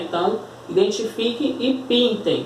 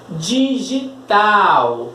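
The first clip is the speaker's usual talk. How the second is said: "Digital" very slowly, DI-GI-TAL with each sound held; syllable by syllable; much slower than usual. Shown as a demonstration. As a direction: stretch and separate every syllable of "Digital". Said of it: "Observe como eu estou falando". ("Digital" bem devagar, DI... GI... TAL)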